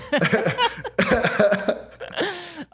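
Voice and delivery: a high, delighted laugh; giggling at the team joke. Real, not acted. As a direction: burst into real laughter. Cracking up together.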